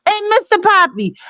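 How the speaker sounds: normal talking voice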